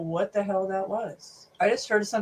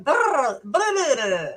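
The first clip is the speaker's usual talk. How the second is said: high, louder blah-blah mimicking the voiceover; mocking babble; 5 semitones higher, 4 dB louder than usual. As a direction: babble at him like a mocking parrot. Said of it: "it went".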